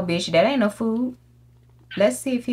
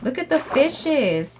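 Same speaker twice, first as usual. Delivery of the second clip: high, slow delighted coo; cooing at fish; 6 semitones higher, slower than usual. Real, not acted. Gamer cooing at the fish she just spotted. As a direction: coo over the little fish.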